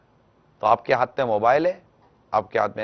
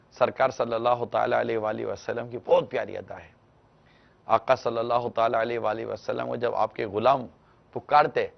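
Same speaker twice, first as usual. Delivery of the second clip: normal talking voice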